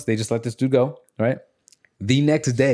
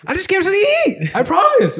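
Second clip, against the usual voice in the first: much higher, louder squeaky plea; mock pleading man; 5 dB louder than usual. Caught man pleading with the host, high and loud. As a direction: beg like you've been caught.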